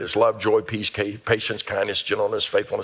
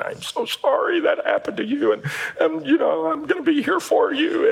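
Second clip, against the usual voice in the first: mock tearful sympathy. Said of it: "the gift of mercy just cries".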